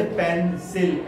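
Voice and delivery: Pencil broken slowly into पें-सिल; split syllables. Shown as a demonstration. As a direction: say pencil with a gap between syllables. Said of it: "ऐसे नहीं बोलते pencil". (Pencil को पें और सिल में तोड़कर, धीरे)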